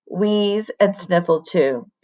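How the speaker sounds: ordinary delivery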